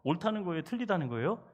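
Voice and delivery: ordinary talk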